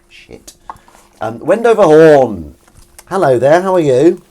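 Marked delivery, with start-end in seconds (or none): 1.4-4.2 loud hailing. Real, not acted. Host greeting viewer Wendover Horn, loud hailing. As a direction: sing out a big hello.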